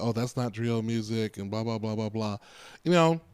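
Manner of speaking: ordinary delivery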